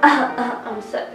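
ordinary delivery

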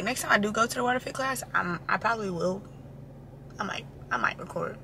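ordinary delivery

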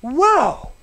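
acted wide-eyed wonder; amazed whoa. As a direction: act out a sudden revelation. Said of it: "suddenly, the answer just falls".

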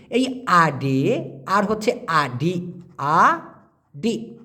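আডে then আডি, very slow, e then i; careful contrast. Shown as a demonstration. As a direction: stretch আডি to show the i ending. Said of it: "খেয়াল করে দেখুন".

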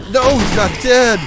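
high, loud panicked cry; panicked yell. Real, not acted. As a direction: yell it in real alarm. Enemy survives attack.

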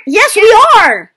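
loud, high, drawn-out exclamation; emphatic shout. Real, not acted. Player correcting a friend on the rules, emphatic shout.

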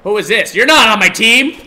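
very high, loud shouting; frantic gamer shouting at an enemy player; yelling. Real, not acted. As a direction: yell at the enemy in panic. Shouting at enemies in game.

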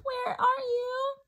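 high, slow squeak calling out; squeaky bat voice. Acted. Baby bat calling for her mother, high squeaky cry. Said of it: "Stella Luna squeaked".

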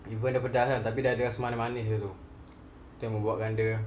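chewing while talking; speaking around food; mouth full. Real, not acted. Seen 1: Cheeks look full and jaw moves, chicken held at mouth.

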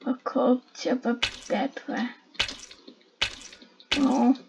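ordinary talk

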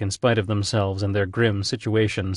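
ordinary delivery

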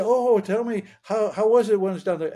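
high, quick, eager asking; eager classmates. Retold or imitated. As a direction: mimic classmates bursting with curiosity. Classmates eagerly asking about death.

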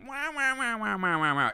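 high mock whine falling away; mock whining. Vlogger imitating the whining instrument, high mock whine. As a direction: copy a whiny instrument with wahs. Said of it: "It sounds whiny".

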